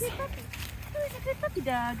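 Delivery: high squeaky puppy talk. Real, not acted. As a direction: coo at the puppy. Baby talk to dog.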